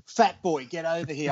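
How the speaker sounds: high mock call across the deck; imitating a boss yelling from the window. Joe calling from window.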